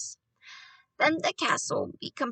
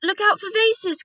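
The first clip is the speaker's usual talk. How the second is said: high, loud, urgent warning; warning call; 9 semitones higher, 8 dB louder than usual. A narrator's reading. Boy warning grandmother waving her stick, calling out. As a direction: call out to prevent a breakage.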